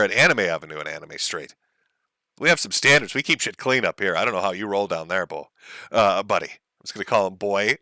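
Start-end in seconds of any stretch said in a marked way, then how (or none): none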